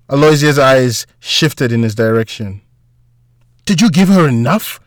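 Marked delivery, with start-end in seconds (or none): 3.6-4.8 raised pitch, demanding question; mild scream. A narrator's reading. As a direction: demand an answer, angry but contained. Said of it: "Walter screamed".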